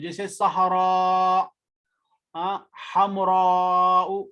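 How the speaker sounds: सहरा and हमराउ slowly, with the final alif held long; long alif drawn out. Shown as a demonstration. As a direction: stretch the last vowel of सहरा and हमराउ. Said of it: "एक है अलिफ ममदूदा जिसके last में मद होती है".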